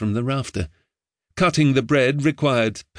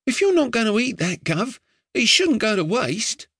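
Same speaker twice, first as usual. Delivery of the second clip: put-on higher boyish voice, earnest and chatty; a street boy urging his doomed master, higher; eager boy Ned; 10 semitones higher than usual. A narrator's reading. Street boy giving his master earnest advice, higher boyish voice.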